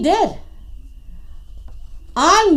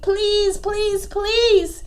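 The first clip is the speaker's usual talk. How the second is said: soft, high pleading; begging; 5 semitones higher, 4 dB quieter, much slower than usual. A narrator's reading. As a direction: plead softly in a higher voice. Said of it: "Iguana begged".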